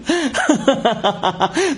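high, jittery nervous giggle; anxious high laugh. Acted. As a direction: laugh off the fear. Nervous laugh facing the gun.

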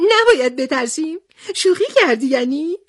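high giggle running into the questions; laughing. A narrator's reading. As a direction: laugh first, then ask in a high little voice. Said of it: "نخودی هم خندش گرفت و گفت".

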